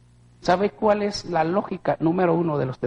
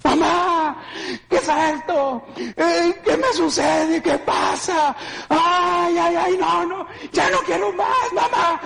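anguished crying; 13 semitones higher, slower than usual. Retold or imitated. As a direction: cry out in anguish and fear. Crying to mother in agony. (llanto angustiado llamando a mamá)

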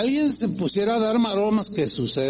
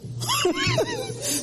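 high laugh. Real, not acted. Laughing at his own imitation.

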